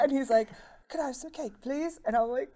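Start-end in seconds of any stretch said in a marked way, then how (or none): 0.9-2.0 higher-pitched, polite bad British accent; mock British accent. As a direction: ask for the cake in a clumsy British impression. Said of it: "forgive my horrible British impersonation".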